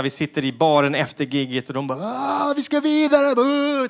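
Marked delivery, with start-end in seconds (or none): 2.0-3.9 put-on high, drawn-out party-kid voice; high, rowdy young comics wanting to party on. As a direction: whoop like hyped twenty-year-olds.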